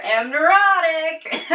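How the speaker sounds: high, slow admission of neurotic; self-mocking emphasis. Real, not acted. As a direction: stretch out am neurotic, high and loud.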